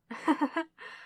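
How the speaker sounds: short, high, light chuckle; a brief scornful laugh at the hero; mocking villain chuckle. Villain laughs at a hero driven by love, mocking.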